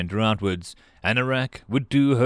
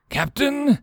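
higher, urgent call to the captain; urgent cry. A narrator's reading. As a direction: cry out in alarm. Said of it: "he cried urgently".

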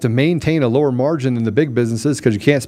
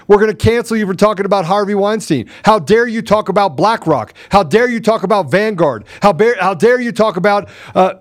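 raised, mocking voice of the powerful; mocking the elites, pitched up and hammed; 7 semitones higher than usual. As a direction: sneer it like a smug elite scolding you.

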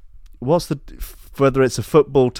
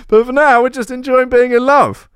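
very high, loud mock Birmingham accent; Brum accent; 5 dB louder than usual. [name] declaring he enjoys being in love, in a mock Brum accent. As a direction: squeal it out in over-the-top Brummie. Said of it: "my Brum accent was more".

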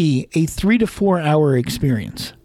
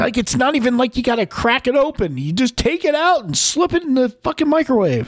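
higher, incredulous rant about the microwave; exasperated rant; 9 semitones higher than usual. Real, not acted. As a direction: rant in disbelief at how easy it is. Ranting about the customer.

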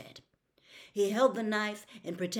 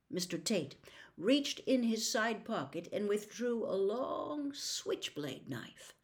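usual speaking voice

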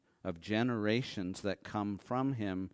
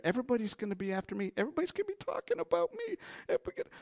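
paranoid babble; 11 semitones higher, much faster than usual. Retold or imitated. Paranoid Cain voiced fast.

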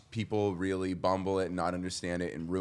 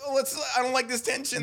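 much higher, fretful pleaser voice; anxious people pleaser; 3 dB louder than usual. Retold or imitated. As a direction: whine it to smooth things over. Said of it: "I'm such a".

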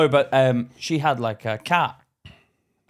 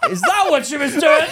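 high, loud question through laughter; laughing disbelief; 11 semitones higher, 5 dB louder than usual. Retold or imitated. Reacting to mum's story to his mate, laughing disbelief. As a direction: ask it incredulously, cracking up.

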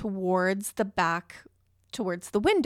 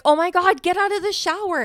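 much higher and louder, rushing the words; panicked yelling; 9 semitones higher, 6 dB louder, faster than usual. Retold or imitated. To her husband, urging him out of the shower, panicked. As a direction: hurry him out in a dramatic fluster. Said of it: "So I'm like".

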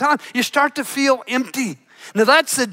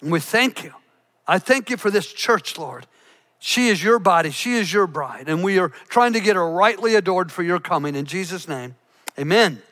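low, soft closing prayer; 6 semitones lower, 3 dB quieter than usual. Acted. Pastor closing a prayer to the Lord, hushed and low. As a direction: pray it quietly and reverently.

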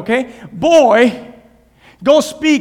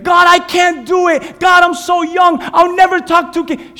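put-on whining child; 8 semitones higher, 5 dB louder than usual. Jeremiah whines to God he is too young, loud childish whine. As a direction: complain like a stubborn kid.

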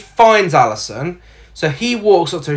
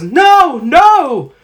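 high, louder retold scream of no; mock scream; 9 semitones higher, 5 dB louder than usual. Girl fleeing the ranger, mock scream. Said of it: "she runs away and says".

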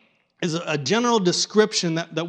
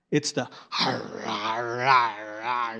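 a growly har, har of a chewing bear; bear chewing noise; 5 semitones lower, 3 dB quieter, slower than usual. Shown as a demonstration. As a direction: imitate a bear gnawing on its prey. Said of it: "You, you can hear it".

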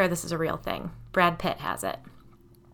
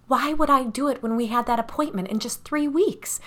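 higher, indignant protest; indignant insistence; 6 semitones higher than usual. A narrator's reading. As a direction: reject the accusation as absurd. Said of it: "she insisted".

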